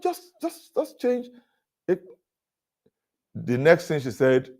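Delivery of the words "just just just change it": much higher, pleading exasperation; impatient retold plea at the hotel desk; exasperated plea. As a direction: beg her to just do it.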